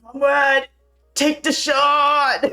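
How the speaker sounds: drawn-out high-pitched shout; hammed-up last words. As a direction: yell it as a desperate dying plea. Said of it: "his last words were like".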